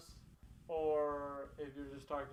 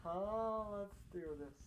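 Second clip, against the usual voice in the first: normal talking voice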